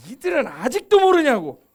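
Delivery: imitated scolding. Jesus telling off the disciples who asked, exasperated scolding. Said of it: "또 지가 혼나죠".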